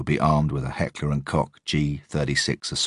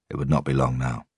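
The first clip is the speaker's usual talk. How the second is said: plain reading voice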